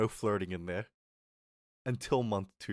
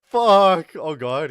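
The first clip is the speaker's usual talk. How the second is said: higher, much louder burst through laughter; loud laughing outburst; 7 semitones higher, 16 dB louder, much slower than usual. Real, not acted. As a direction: blurt it out still cracking up.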